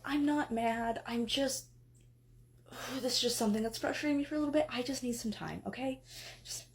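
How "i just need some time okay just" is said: a weary whispered plea. Acted. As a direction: breathe it out, barely voiced. Wife pleads for space.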